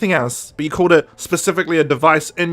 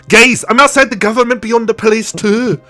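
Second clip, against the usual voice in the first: "outside the government, beyond the police" called out higher and louder, slowing at the end; mock pizzeria guy; 7 semitones higher, 4 dB louder than usual. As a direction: ham it up like a proud pizza man announcing himself. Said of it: "Dennis from the pizzeria goes".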